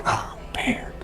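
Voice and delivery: breathy whispered oh man; whispered dismay. Retold or imitated. Hearing the thousand-dollar fee, a dismayed whisper. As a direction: whisper it under your breath, deflated. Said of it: "I'm like".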